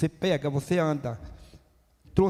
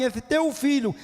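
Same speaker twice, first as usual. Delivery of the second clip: raised, disdainful 'Esse teu filho' with 'teu' hit hard; scornful; 5 semitones higher than usual. Acted. Older son rejecting his brother before the father, disdainful. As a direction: stress 'teu' as if the brother were not his. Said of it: "em tradução ele fala assim".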